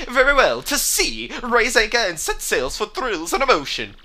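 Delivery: high, theatrical, swooping captain voice; hammed-up jubilant captain; pompous ship's captain.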